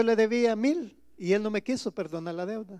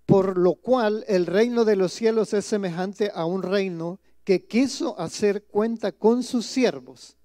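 louder, slow and solemn Bible reading; scripture reading; 5 dB louder, slower than usual. Acted. Pastor reading the parable aloud to his congregation, solemn and loud. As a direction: proclaim the verse to the congregation.

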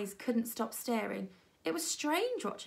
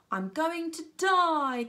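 high, slow, louder wail; dramatic cry; 8 semitones higher, 6 dB louder, much slower than usual. A narrator's reading. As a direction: wail it like a doomed child. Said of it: "came a cry".

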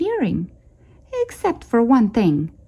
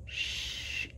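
whispered, much softer shush; whispered warning shush; hissing shush; 20 dB quieter than usual. A narrator's reading. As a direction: hush the others with a hiss. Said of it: "hissed Pip".

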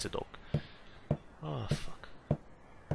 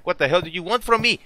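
ordinary talk

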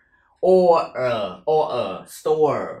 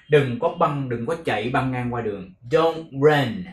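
ordinary delivery

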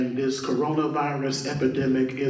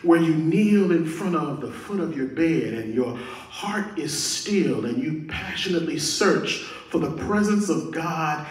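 normal talking voice

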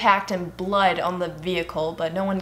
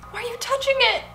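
grossed-out shriek; 4 dB louder than usual. Real, not acted. Grossed out by body.

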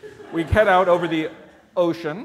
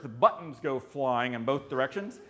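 usual speaking voice

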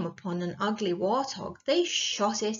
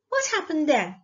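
higher-pitched curious question; eager young listener; 6 semitones higher, 4 dB louder than usual. A narrator's reading. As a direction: beg to hear what comes next. Listener urges the story on.

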